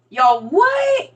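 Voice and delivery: high, incredulous "what"; baffled shout, pitch shooting up; mock disbelief. Baffled listener to Saint Louis speaker, mock disbelief.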